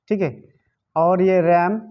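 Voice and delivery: ordinary talk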